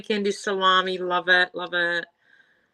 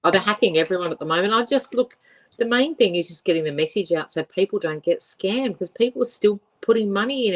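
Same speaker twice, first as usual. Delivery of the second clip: ordinary delivery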